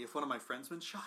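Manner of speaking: normal talking voice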